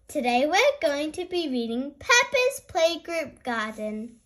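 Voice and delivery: plain reading voice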